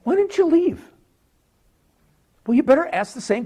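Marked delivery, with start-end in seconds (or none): 0.0-0.8 much higher, stress on "you"; pleading high question. Shown as a demonstration. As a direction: fling "Why didn't you leave?" up high, leaning on "you". Repeats the question to Lot.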